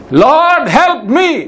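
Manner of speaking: high, pleading cry to the Lord; a high crying-out plea; desperate plea. Pretend. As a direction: beg for help like a desperate mother. Woman cries out to Jesus.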